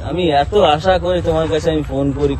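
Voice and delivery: ordinary talk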